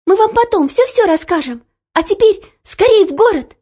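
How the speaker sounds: put-on high, excited girlish urging; urgent girl. A narrator's reading. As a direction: press him to hurry, pleading. Girl urges coachman to hurry.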